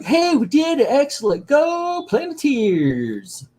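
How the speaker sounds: high-pitched hammed-up cheering kids; mock kids' cheer. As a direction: cheer like cartoon kids, drawing out the end. Kids cheering in retold cartoon.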